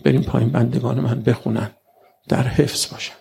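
God's calm answer. Acted. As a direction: answer gently from above. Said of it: "خدا گفت".

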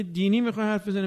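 suspicious question. Retold or imitated. Suspicious listener voiced.

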